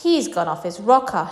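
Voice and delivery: plain reading voice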